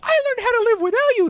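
very high mock-whiny girl voice; mocking Iris scolding Barry in a very high whine. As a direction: squeal the complaint like a pouty wife. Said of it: "Iris is like".

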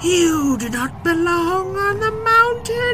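very high, slow, loud wind spirit; booming wind voice. Acted. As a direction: roar it like the wind itself. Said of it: "the wind shouted back".

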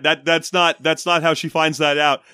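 ordinary delivery

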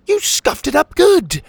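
loud, very high-pitched complaint; upset scolding. A narrator's reading. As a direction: squawk it accusingly. Character scolds over damaged shell.

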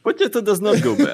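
a joking comic-Russian line about potatoes; Russian accent. Pretend. Host jokes about space potatoes, hammed-up Russian accent. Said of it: "I do my Russian accent".

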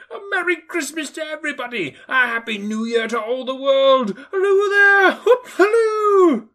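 very high, building to a whooping shout; old man giddy as a drunk, shouting halloo; giddy Scrooge. Acted. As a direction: whoop for joy like a schoolboy. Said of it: "laughing and crying in the same breath".

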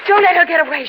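loud alarm, don't let her escape; urgent call. Acted. Laura warns the hero, loud and urgent. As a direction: shout the warning.

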